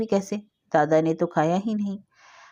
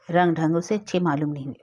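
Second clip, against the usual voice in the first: ordinary delivery, nothing marked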